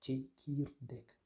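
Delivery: tchekirdek said slowly, every syllable apart; slow model word. Shown as a demonstration. Teacher slowly models tchekirdek for the class. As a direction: spell out tchekirdek slowly for learners. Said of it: "On a le mot".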